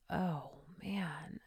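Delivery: breathy whisper of dismay. Retold or imitated. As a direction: whisper it as a spirit would. Spirit reacts as she enters.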